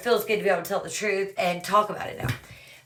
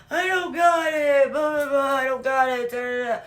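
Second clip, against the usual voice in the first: mock crying; 5 semitones higher than usual. Dude confronted over the money he owes, mock crying. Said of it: "Oh, dude starts crying".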